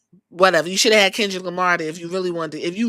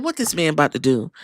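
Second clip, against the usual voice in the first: fast, lower, softer muttered question; quick low mutter; 6 semitones lower, 4 dB quieter, faster than usual. Retold or imitated. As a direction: rush it under your breath. Asks the same thing twice.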